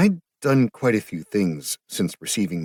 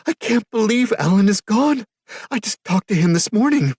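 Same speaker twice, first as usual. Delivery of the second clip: very high, loud, crying voice; bursting into tears; 8 dB louder than usual. A narrator's reading. Sister sobbing over her brother's death, high wailing. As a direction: let the grief spill out in a wail. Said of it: "She burst into tears again".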